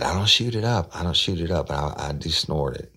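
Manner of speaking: imitating a suspect's high protest, then settling; mimicking her denial. Retelling the suspect's denial to the listeners, mimicking her high protest. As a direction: go high and insistent on the first denial, ease down after. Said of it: "She said".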